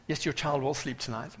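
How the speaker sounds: normal talking voice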